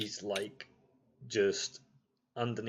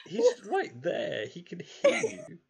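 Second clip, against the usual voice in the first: high, laughing panic; panicked whisper-plea; 6 dB louder than usual. Acted. Frightened NPC begging the player to stop, high and panicky. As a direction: plead in frantic squeaks.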